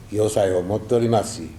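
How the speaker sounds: ordinary talk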